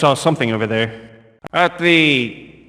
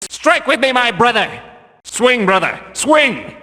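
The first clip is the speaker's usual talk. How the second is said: rallying cry; 7 semitones higher than usual. Acted. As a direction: drive your brother on. Guard rallies brother in fight.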